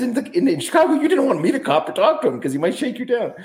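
high mock old-man voice; dad's voice. Dad telling his son about Chicago cops, in a high mock voice.

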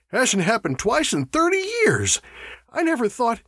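high-pitched, agitated disbelief; alarmed. A narrator's reading. Burford to his mates over a rare reaction, alarmed. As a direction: pitch it up in dismay.

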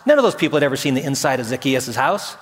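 ordinary talk

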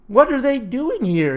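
high, loud alarmed holler. A narrator's reading. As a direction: holler it out in dismay. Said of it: "he hollered".

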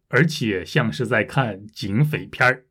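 normal talking voice

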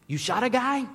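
high, loud incredulous question; shocked disbelief. Retold or imitated. Lost tourist mishearing a French stranger, shocked disbelief. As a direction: blurt it out in alarmed disbelief. Acts out his shocked misunderstanding.

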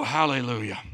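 ordinary talk

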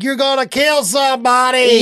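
high yelled warning; mock warning yell. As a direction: shout it from the roadside. Roadside people yelling warning.

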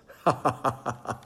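booming scornful laugh; a cruel giant's laugh; giant's mocking laugh. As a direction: laugh down at the tiny boy. Goliath laughs.

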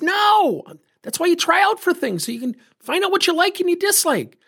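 very high-pitched, eager "not at all"; emphatic reassurance. Retold or imitated. Coach telling a kid he is not mad, emphatically. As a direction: pitch way up, insist it's totally fine.

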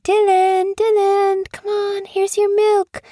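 put-on high sing-song call to a baby; coaxing mother. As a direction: coax in a very high-pitched voice. Mother coaxing sleepy newborn to feed.

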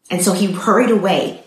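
plain reading voice

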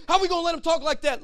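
high, loud indignant protest; outraged David. Acted. David urging his brothers to act, outraged shout. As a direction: shout it as a furious challenge.

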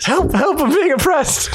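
mock cry for help. Monty Python quote, shouted high.

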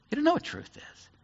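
usual speaking voice

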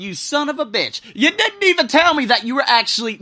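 loud, high indignant outburst; retold indignant yell; mock outrage. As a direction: scold a friend in hammed-up fury. Retold outburst at secretive friend.

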